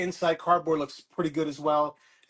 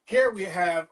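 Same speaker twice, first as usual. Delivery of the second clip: ordinary delivery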